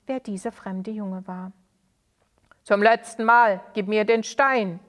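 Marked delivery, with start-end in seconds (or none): 2.6-4.8 loud, gruff final order; old villain's gruff final demand to Sali; harsh demand. Acted. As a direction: bark the last warning. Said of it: "Die Stimme des Alten klang sehr schroff".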